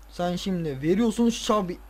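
ordinary talk

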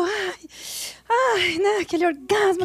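half-hearted pretend orgasm sigh; faked orgasm moan. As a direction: lightly fake a moan of pleasure. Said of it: "Aí a gente fingiu".

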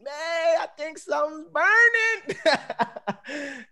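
high, loud, drawn-out holler; great-grandmother yelling down. Retold or imitated. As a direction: imitate great-grandma hollering down from upstairs, stretching the last word. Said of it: "my great grandmother was yelling down".

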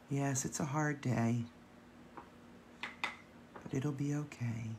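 gentle, hushed Mister Rogers voice. Pretend. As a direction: soothe them softly and kindly. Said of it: "such a soft voice, so calming".

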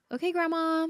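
high-pitched, gingerly acted address; tentative spirit-calling. Granddaughter addressing her late grandma while driving, high tentative call.

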